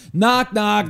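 put-on high, loud kid voice; a kid proudly starting his joke. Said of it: "and the kid's like".

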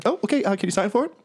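very high, hesitant courier voice; meek little messenger; delivery runner. Acted. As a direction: squeak out a polite request.

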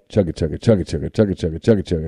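low chugging train noise. Retold or imitated. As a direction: chug it out like a toy train. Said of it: "the train takes off".

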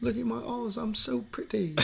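high, soft, hammed-up English voice; mock English accent. Mocking the pretty actor, silly high English accent. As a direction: coo it in a fake English accent. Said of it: "That was, like, English".

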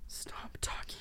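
whispered, shaken plea; horrified whisper. Acted. Woman begging killer ex to stop, whispered. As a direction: breathe it out in horror.

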